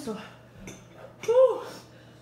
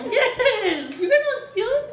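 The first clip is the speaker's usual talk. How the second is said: laughing; 11 semitones higher, 6 dB louder than usual. Real, not acted. Burning mouth, laughing.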